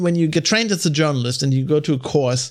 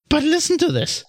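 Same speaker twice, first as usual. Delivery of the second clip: mock podcast host voice; 8 semitones higher than usual.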